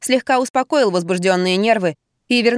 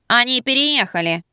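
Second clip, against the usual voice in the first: disguised, twangy nasal voice; nasal, pinched nose; 3 semitones higher than usual. A narrator's reading. Wife disguising herself to a caller, nasal. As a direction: talk as if pinching your nose. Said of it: "Я зажала нос пальцем и прогундосила".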